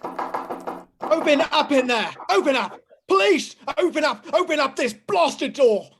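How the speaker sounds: loud, slow commands through the door; police shouting. Acted. Police sergeant shouting at the door, loud and demanding. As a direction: bark orders like a cop at a door.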